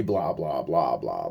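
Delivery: dismissive mumbled filler; dismissive blah blah. Retold or imitated. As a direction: brush it off with lazy blahs. Dismissive mock retelling.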